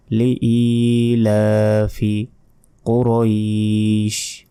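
কুরাইশ with a long-held final vowel; slow Quran-teacher model, stretching the vowels in লিইলাফি কুরাইশ; drawn-out recitation. Shown as a demonstration. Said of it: "লা এক second টান হবে".

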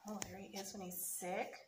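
plain reading voice

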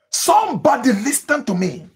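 normal talking voice

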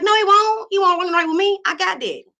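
high, loud indignant insistence; high protest. Retold or imitated. Protesting to brother.

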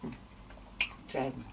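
talking while chewing food; muffled through a full mouth. Real, not acted. Seen 1: Bit chicken at -9; cheeks full, chewing throughout the words.

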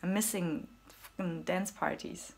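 usual speaking voice

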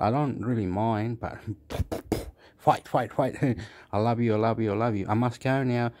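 ordinary talk, nothing marked

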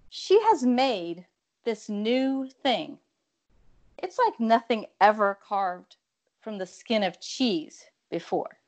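slow, measured poetry reading with long pauses; poem reading. Acted. Poet reading her Betty Crocker poem to the audience, measured and theatrical. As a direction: read it like a stage poem, savouring each image.

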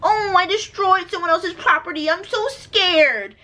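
hammed-up fake-frightened whine; mocking the driver. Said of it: "he said he took off because he was scared".

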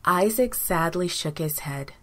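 plain reading voice

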